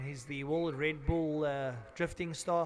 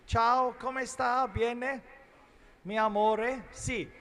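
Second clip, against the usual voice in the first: loud, high, slow mock Italian greeting; exaggerated Italian greeting; hammed-up Italian; 7 semitones higher, 7 dB louder, slower than usual. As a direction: ham up an Italian hello to the viewers. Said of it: "Little bit of Italian".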